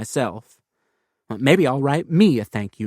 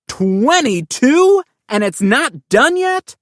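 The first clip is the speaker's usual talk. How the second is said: high, louder, drawn-out outrage; shocked disbelief; 11 semitones higher, 6 dB louder, slower than usual. Acted. Kid counting pages, appalled the book isn't done. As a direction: stretch the disbelief out slowly.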